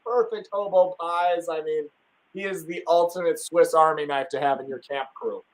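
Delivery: ordinary talk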